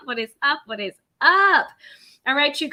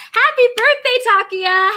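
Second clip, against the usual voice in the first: much higher and louder, stretched out; excited birthday cheer; 9 semitones higher, 5 dB louder than usual. Real, not acted. Host cheering a viewer's birthday, excited and loud. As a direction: burst out with joy for her.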